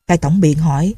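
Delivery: plain reading voice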